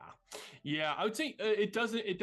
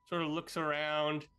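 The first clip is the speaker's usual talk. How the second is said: ordinary delivery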